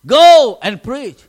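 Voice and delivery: slow, loud divine command; loud, slow and weighty. Retold or imitated. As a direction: give the order like the Spirit speaking. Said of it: "the Spirit came to me".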